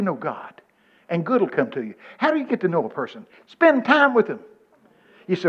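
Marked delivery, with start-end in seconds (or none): 3.5-4.4 high and loud, driving the point home; emphatic answer. Real, not acted. Preacher answering how you get to know someone, emphatic. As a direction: hit the answer hard.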